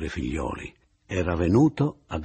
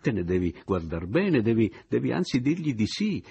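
incalzante; 6 semitones higher, 5 dB louder than usual. Acted. Narratore incalza Oliva, concitato.

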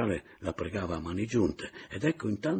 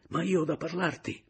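higher, grim and insistent; dark outburst; 9 semitones higher than usual. A narrator's reading. Papiano insists to Adriana, grim and insistent. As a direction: lash out darkly but hold it in. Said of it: "inveì il cognato con fosca maniera".

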